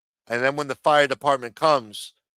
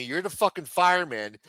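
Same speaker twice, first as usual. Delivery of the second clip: usual speaking voice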